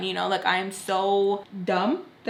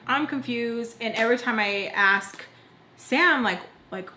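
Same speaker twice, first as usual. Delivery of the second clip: usual speaking voice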